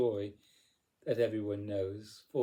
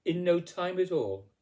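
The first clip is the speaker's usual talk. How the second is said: usual speaking voice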